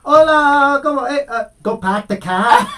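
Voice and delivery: high, loud, laughing mock Spanish accent. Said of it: "you're like".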